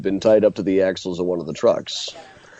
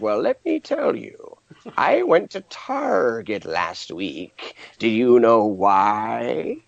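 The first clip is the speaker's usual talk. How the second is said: witch voice; much slower than usual. Retold or imitated. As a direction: stretch every word like a fairy-tale witch. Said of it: "she talked like the Wicked Witch".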